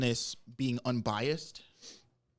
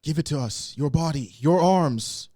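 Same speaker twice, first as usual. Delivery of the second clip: creepy ghost voice; 3 semitones higher, 7 dB louder, slower than usual. Acted. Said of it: "creepy ghost whisperer voice".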